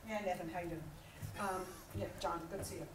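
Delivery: low, soft, unenthused hello; flat bored greeting. Shown as a demonstration. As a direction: greet him like he's furniture.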